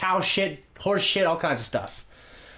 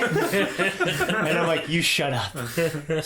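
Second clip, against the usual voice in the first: laughing; 3 semitones higher, much slower than usual. Real, not acted.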